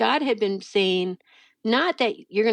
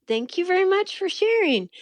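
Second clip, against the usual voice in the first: much higher, put-on polite voice; over-polite thanks; 11 semitones higher than usual. Reply to a stranger's unwelcome warning, high over-polite thanks. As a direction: thank them in a high, sing-song polite tone.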